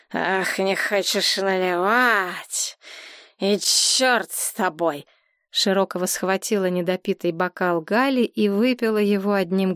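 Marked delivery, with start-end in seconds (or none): 0.1-5.1 slow, drawling tipsy huff; drunk defiance. A narrator's reading. Drunk customer gives up on the barman, slow defiant drawl. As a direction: draw it out in drunken contempt.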